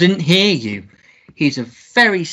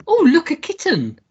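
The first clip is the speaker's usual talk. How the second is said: sudden delight; 10 semitones higher than usual. Acted.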